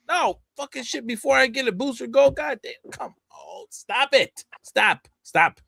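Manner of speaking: high, frustrated pleading to stop; exasperated outburst. Real, not acted. A fan ranting at DC to stop, exasperated. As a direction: beg them to quit it, voice climbing. Ranting at DC about Booster Gold.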